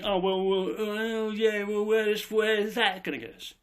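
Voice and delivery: mock sceptical whine; doubting listener. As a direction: voice a dithering doubter, stretching out the wells. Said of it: "you might say to me".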